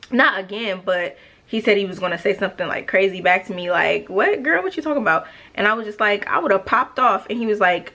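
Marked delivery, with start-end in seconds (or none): 4.1-5.3 high-pitched, sassy disbelief, imitating him; mock incredulous comeback. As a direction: throw it back high, as if imitating someone affronted. Acting out his crazy comeback.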